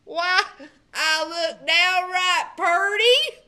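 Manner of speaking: amazed at his new clothes; delighted exclamation. A narrator's reading. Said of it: "exclaimed Bubba".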